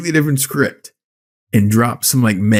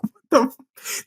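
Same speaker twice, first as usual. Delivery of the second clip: slow, high, softer mock disbelief, cut off; incredulous squeak; 12 semitones higher, 5 dB quieter than usual. Friend retelling the pier escape, incredulous squeak. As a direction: squeak and give up, baffled.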